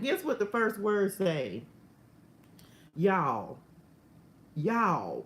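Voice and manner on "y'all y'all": "Y'all" as a falling, punchy exclamation; exclamation as written. Acted. Reading the notes' first word to viewers, exclaimed "Y'all". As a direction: set each "Y'all" apart and let it drop. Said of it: "With an exclamation".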